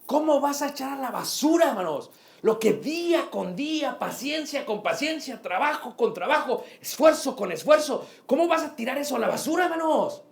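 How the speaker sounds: preacher pleading with married brothers; impassioned preaching. Acted. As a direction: shake them with the question. Preaching climax.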